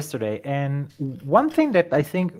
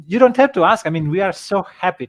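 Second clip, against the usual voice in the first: excited; 4 semitones higher, 6 dB louder than usual. Retold or imitated. Eager reply to collaboration request.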